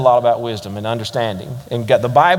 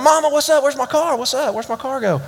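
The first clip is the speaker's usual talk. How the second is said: much higher, urgent questions to mom; frantic son; 12 semitones higher than usual. Retold or imitated. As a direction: rush in asking where it went. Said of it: "I come in".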